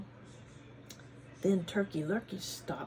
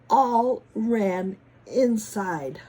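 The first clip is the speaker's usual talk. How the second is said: slow loud; 10 dB louder, much slower than usual. A narrator's reading. Dramatic climax line.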